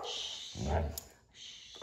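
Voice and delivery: soft, unvoiced shushing; hushing the dog. Real, not acted. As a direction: shush a pet very quietly.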